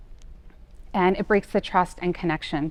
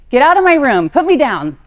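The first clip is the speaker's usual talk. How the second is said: higher, louder imitation of a child's tantrum yell; 7 semitones higher, 12 dB louder than usual. Daughter yelling at her mother during a tantrum, shouting. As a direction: yell like an upset six-year-old.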